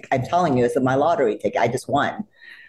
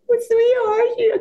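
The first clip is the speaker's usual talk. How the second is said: high, louder choked squeak; overwhelmed squeak; 4 dB louder than usual. Retold or imitated. Fan overwhelmed by her idol's kindness, squeaking.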